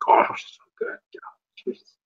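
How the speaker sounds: whispered mumble under the breath; mumbling. Acted. Vampire savouring blood as she drinks, mumbling under her breath. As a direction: mumble it blissfully, half to yourself. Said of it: "mumbling that under her mouth as she's drinking".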